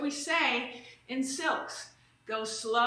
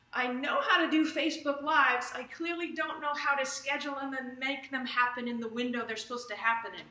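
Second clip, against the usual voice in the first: usual speaking voice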